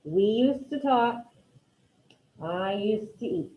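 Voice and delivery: We used to talk and I used to eat read slowly, each word separate; teacher dictating phrases to the class; slow dictation. Shown as a demonstration. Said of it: "with these first two".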